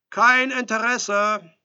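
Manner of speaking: loud, high, stretched-out brush-off; shouted refusal. A narrator's reading. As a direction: draw it out in a firm shout. Edelstein shouts back at door.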